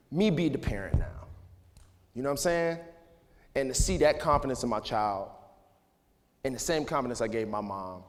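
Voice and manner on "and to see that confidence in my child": ordinary talk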